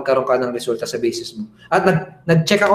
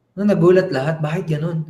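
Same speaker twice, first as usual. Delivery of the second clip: ordinary talk, nothing marked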